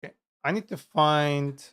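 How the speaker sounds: usual speaking voice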